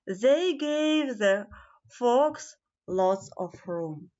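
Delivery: ordinary delivery, nothing marked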